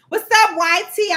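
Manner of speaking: loud upbeat hello to the chat; excited greeting. Real, not acted. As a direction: call out a bright hello.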